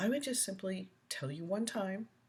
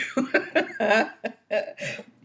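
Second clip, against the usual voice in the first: big laugh; 6 semitones higher, 9 dB louder than usual. Real, not acted. Laughs at viewer John.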